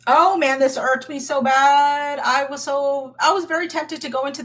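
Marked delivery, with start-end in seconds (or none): none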